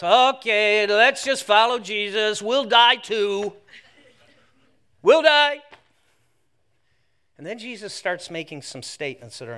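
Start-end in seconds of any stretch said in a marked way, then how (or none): 0.0-5.6 loud, drawn-out comic resignation; resigned disciple. Acted. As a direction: give in loudly, as if heading off to die. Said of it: "finally one guy goes".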